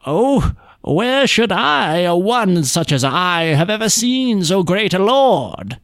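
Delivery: high, slow fawning beggar voice; humble beggar. A narrator's reading. Untrue the beggar to his rich brother, high and fawning. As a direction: cringe and drawl like a ragged beggar. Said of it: "said Untrue".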